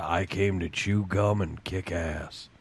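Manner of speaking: deep, slow action-hero voice; low slow one-liner, tough guy; Duke Nukem. Retold or imitated. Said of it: "And he still says the".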